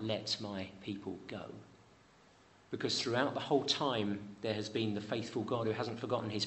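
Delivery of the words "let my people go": solemn command. Acted. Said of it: "there are those words spoken out".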